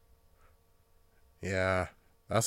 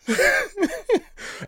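high laugh. Real, not acted.

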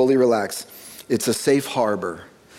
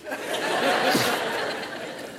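breathless laugh; 10 semitones higher, 7 dB quieter than usual. Retold or imitated. Recalling breaking down.

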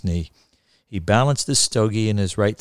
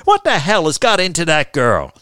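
loud, higher, exasperated anger; irritated raised voice; angry outburst; 7 semitones higher, 7 dB louder than usual. A narrator's reading. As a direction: spit it out in annoyance. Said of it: "spit out in some anger".

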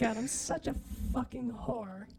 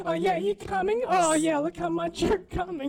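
higher, loud porn voice; mock sexy voice; 5 semitones higher, 9 dB louder than usual. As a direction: ham up a porn star.

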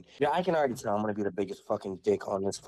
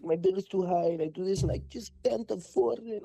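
high, mock whiny complaining voice; whiny customer; 7 semitones higher than usual. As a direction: imitate the lady whining about her bill. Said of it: "She's like".

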